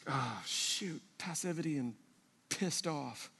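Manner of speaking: very soft, low sulky aside; retold grumble. Husband reacting to Laura's challenge, mock sulky undertone. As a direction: imitate grumbling under your breath.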